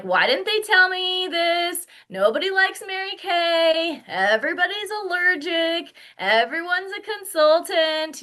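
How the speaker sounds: high-pitched indignant complaint; hammed-up cross whine; acted anger. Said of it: "Stage six is you get angry".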